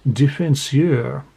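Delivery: Defenseur in a slow, hammed-up French accent; mock French pronunciation of a hockey position. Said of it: "My French accent is amazing".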